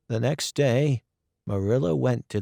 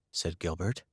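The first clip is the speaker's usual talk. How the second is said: usual speaking voice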